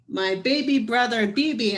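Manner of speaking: usual speaking voice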